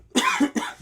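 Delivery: harsh choking cough; coughing up water. Real, not acted. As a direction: cough hard after water went down wrong. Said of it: "Water went down the wrong one pipe".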